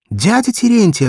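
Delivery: put-on high, loud child's call. Little girl calls out to the drunk shoemaker, high loud cry. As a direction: call him across the garden beds.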